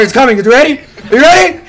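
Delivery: high, loud hyped yelling; excited shout. Real, not acted. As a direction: scream with excitement. Hyped countdown then scream.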